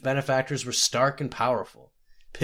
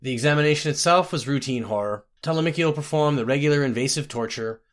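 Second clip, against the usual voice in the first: ordinary delivery, nothing marked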